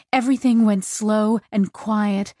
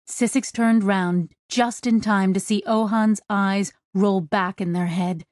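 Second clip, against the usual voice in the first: ordinary delivery, nothing marked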